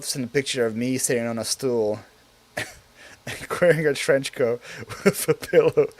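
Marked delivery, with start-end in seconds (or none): none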